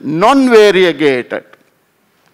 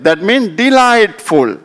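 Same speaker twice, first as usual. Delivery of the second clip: ordinary talk, nothing marked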